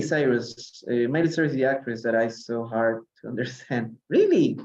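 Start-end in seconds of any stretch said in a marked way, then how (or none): none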